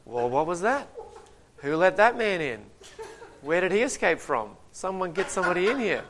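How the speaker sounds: a startled king calling for guards, half amused; flustered king. Acted. Ahab on his throne after Elijah storms out, flustered and baffled.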